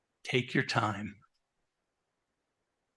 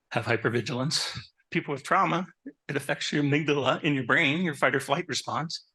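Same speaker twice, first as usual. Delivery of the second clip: normal talking voice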